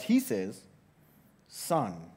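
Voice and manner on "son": much softer, lower, gentle address; father calming his angry elder son, very tender; tender fatherly. Retold or imitated. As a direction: speak to your son with soft love. Father answers again tenderly.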